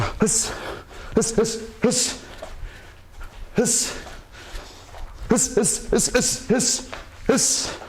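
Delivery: sharp hissed exhales, one per punch; punchy "hiss" bursts with gaps between; strike breathing. Shown as a demonstration. Coach throwing punches to show viewers, hissed strike breaths. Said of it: "We breathe on our strikes".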